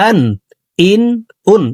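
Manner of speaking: আন, ইন, উন each begun with a hard glottal stop; short, firm onsets on আন, ইন, উন; firm Hamza. Shown as a demonstration. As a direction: hit the hamza firmly at the start of আন, ইন, উন. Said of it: "হামজার উচ্চারণ শক্তভাবে পড়তে হবে".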